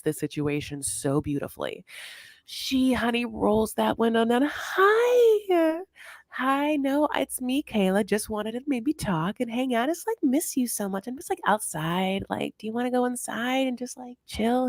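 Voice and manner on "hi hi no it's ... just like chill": sweet-talking Kayla. Retold or imitated. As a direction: coax him into letting her in. Kayla sweet-talking Kev at window.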